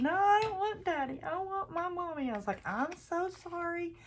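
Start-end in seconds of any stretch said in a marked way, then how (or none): 0.0-2.3 put-on high-pitched whining little girl; whiny child. Granddaughter crying at bedtime, whining high child voice. 2.7-3.9 mock whine. Mocking the child's whine.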